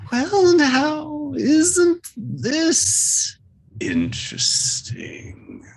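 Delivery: high, sly creature voice ending in a whisper; creepy creature voice. Acted. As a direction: purr it out like a curious monster.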